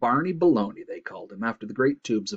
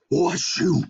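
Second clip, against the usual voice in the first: loud, acted moose sneeze; giant sneeze; 7 semitones higher, 6 dB louder than usual. A narrator's reading. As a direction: let out a huge sneeze. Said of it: "the next tree over sneezed".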